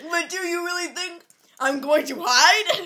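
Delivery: put-on lightly whiny angsty teen; a hint of a sulky emo teen. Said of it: "talk like an angsty teen".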